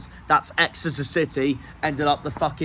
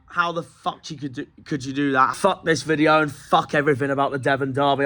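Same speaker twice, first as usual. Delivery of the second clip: usual speaking voice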